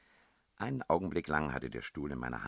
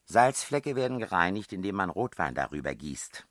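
loud mock-instructional tone, starting high; lecturing; 6 semitones higher, 10 dB louder than usual. A narrator's reading. Princess teaching the man about stains, mock lecture. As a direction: recite it like a household rule. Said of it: "lehrte die Prinzessin".

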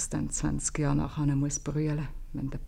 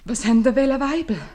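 sharp question; 7 semitones higher, 10 dB louder than usual. Acted.

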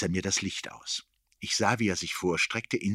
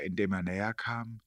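usual speaking voice

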